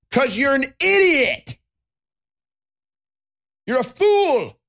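high, drawn-out mocking yell; scornful outburst. Host answering his own question to mask wearers, scornful outburst.

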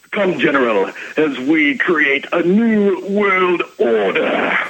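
slow, grand Russian general voice; bad acting. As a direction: ham up a villain's announcement. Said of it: "Sorry for my bad acting".

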